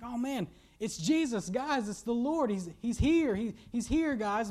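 high, eager outburst; excited realisation. Acted. John tells the disciples Jesus is on shore, excited. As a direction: tell your mates in rising excitement.

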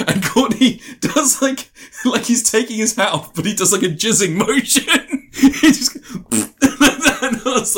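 high words broken by laughter; laughing. Real, not acted. Friend recounting a crude film gag, laughing through it. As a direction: tell it while cracking up.

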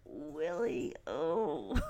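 imitating a cringing kid saying Willie. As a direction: say the name like it tastes bad. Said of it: "she cringes as she says it".

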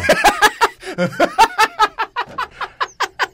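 high, helpless giggling; long real laugh. Real, not acted. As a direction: crack up at your own joke. Host cracking up at own joke.

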